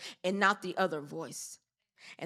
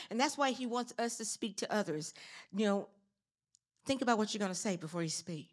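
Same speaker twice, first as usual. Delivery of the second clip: ordinary delivery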